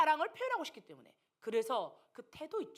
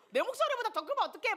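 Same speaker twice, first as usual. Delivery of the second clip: mock teacher complaint; 10 semitones higher, 5 dB louder than usual. Teacher voiced in story.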